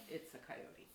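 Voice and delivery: whispered aside to herself. Retold or imitated. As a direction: breathe it out unvoiced. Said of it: "I'm like".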